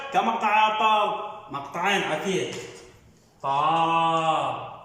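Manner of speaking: slow, stretched طارق; syllable drill. Shown as a demonstration. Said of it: "نقطع بدينا". (تقطيع بطيء لكلمة طارق)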